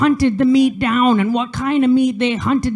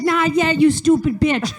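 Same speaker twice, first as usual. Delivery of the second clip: mock snap; 5 semitones higher than usual. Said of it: "I didn't really say that".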